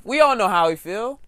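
usual speaking voice

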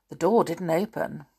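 plain reading voice

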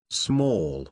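robot dropping down on small; glitching robot. Acted. Robot loops on paradox.

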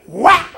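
high, loud imitated whip crack; whip crack sound. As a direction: snap out a mock whip lash. Imitating whip striking the guy.

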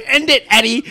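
loud, high yelled order; real yell. Real, not acted. Host ordering the producer to end the show, yelled. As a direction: yell it over the chatter. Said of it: "he yelled".

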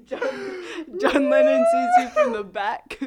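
high, loud squeal through laughter; laughing hard. Real, not acted. Teasing a friend about [name], laughing helplessly. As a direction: squeal it out, cracking up.

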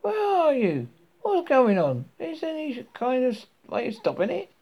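high, loud frantic questions; frantic high-pitched cry; calling out. A narrator's reading. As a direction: holler for whoever is out there. Ford calls to unseen voice.

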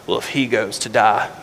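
low, hushed and grave, dropping to a whisper; grim, resolved Thomas. Retold or imitated. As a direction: say it like a man ready to die. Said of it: "Thomas kinda looks at the group and says".

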